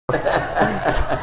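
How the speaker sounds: quiet trailing chuckle; light amused laugh after the punchline; soft laugh. Real, not acted. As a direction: let a soft laugh fade out. End of funny story.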